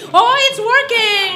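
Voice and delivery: high, loud delighted cry; excited shout. Real, not acted. Comedian sees the Spanish bit land, excited shout. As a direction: burst out in sudden triumph. Host delighted the crowd finally laughs.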